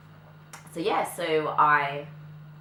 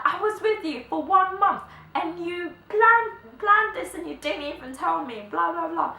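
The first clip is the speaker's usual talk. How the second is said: high, loud imitation of a protesting dad; mock indignant; 13 semitones higher, 6 dB louder than usual. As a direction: play up an outraged complaint, much higher and louder. Dad's retold protest at surprise.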